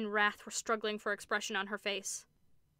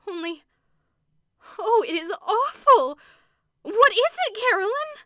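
horror-stricken; 12 semitones higher, 14 dB louder than usual. A narrator's reading. Missus Brigham begs Carolyn to explain the shadow, horrified high cry. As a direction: plead in horror. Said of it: "whose horror-stricken tone matched her sister's".

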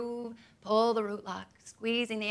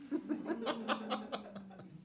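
soft laugh; 9 dB quieter than usual. Real, not acted. Laughs after the quote.

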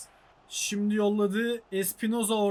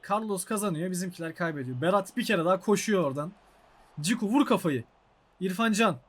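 ordinary delivery

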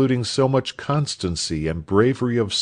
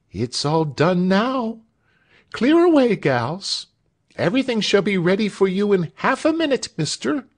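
very high comic servant voice; high-pitched, busy servant woman; bustling maidservant; 12 semitones higher than usual. A narrator's reading. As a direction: chirp orders and promises in a high pitch, lifting at the end. Bustling maid answers the doctor.

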